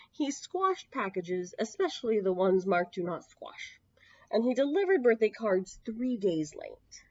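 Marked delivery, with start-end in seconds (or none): 3.0-3.8 hushed, breathy reading of the label; whispered label. A narrator's reading. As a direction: read the sign in a soft whisper.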